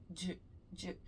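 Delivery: D said with the lips in an O shape; the Brazilian d, lips rounded, softly; d with the lips pushed into an O. Shown as a demonstration. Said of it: "ao invés de falar de, com o lábio em forma de O".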